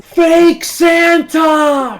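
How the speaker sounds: very high, long-held yell; accusing shout. Acted. Billy denounces Santa before the waiting children, drawn-out shout. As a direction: yell it out, stretching each word.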